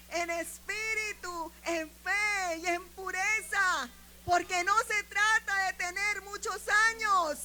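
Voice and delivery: loud, high, drawn-out proclamation; full-force preaching. Real, not acted. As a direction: proclaim God's word to the whole church at full voice.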